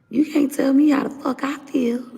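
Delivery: sudden high furious yell; angry outburst. Real, not acted. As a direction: snap it out high and hot.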